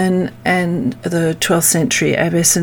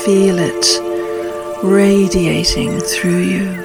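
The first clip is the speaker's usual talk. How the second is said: calm grounding exercise. Acted. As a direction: guide them gently into stillness. Leads a meditation.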